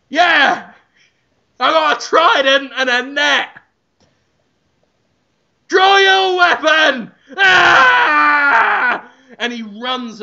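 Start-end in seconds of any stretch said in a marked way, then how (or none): 0.1-7.1 battle shout. Acted. Ramsay demanding a street fight. 7.4-9.1 battle cry. Acted. Ramsay charging with trident.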